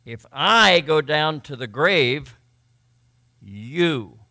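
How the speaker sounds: "I" loud and drawn out, "you" alone after a pause; emphatic I and you. Shown as a demonstration. Preacher showing emphasis on the you, emphatic and slow. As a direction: lean hard on "I", then drop a separate, weighty "you". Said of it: "So you see the emphasis there".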